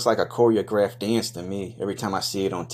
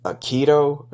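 loud, high disbelieving question; can't believe what you see; surprised; 7 semitones higher, 7 dB louder, slower than usual. Real, not acted. Finds an Aikido dojo.

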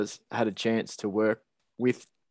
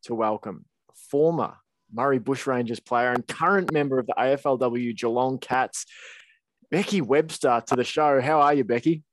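booming announcer welcome, louder; grand entrance announcement; 3 semitones higher, 4 dB louder than usual. Acted. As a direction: announce her like a boxing MC. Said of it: "I finally put together the perfect intro".

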